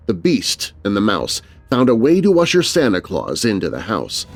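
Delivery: usual speaking voice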